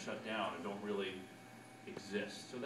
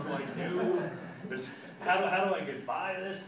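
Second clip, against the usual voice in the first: raised, louder frantic self-questioning; mock desperation; 6 semitones higher, 5 dB louder than usual. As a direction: act out a comic predicament, pitched up. Acting out his bathroom predicament.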